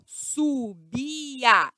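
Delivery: "subia" stretched slowly into su-bi-a; three separate syllables. Shown as a demonstration. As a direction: give "subia" three long, distinct beats. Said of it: "São três sílabas".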